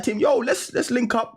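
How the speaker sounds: high-pitched hype invite; high excited call. Retold or imitated. Messaging his brother, high-pitched and eager. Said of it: "I was like".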